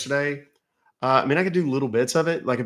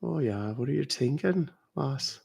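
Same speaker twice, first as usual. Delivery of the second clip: put-on Irish accent; 3 semitones higher, 6 dB quieter than usual.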